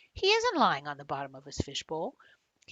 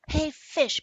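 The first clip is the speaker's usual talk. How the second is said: loud high call across the gym; 10 semitones higher, 10 dB louder than usual. A narrator's reading. Boy calling out to the goldfish, loud and high. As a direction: shout it out to get the fish's attention. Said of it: "Ben calls".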